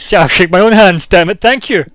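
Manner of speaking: high, loud playful outburst; loud joking exclamation. Real, not acted. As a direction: shout the joke with glee. Joking self-handshake, loud.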